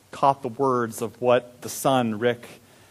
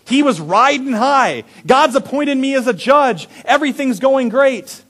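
cocky excitement; 9 semitones higher, 5 dB louder than usual. Retold or imitated. Gideon boasting, riding high.

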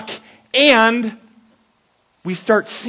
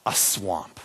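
hushed reveal; 11 semitones lower, 11 dB quieter than usual. A narrator's reading. Suspense reveal.

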